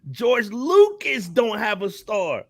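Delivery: ordinary delivery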